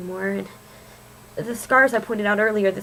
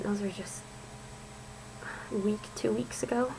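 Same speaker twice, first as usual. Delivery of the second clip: whispering; 12 dB quieter than usual. Real, not acted. Confessing scars, trailing into hush.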